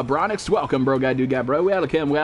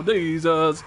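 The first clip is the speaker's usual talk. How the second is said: ordinary talk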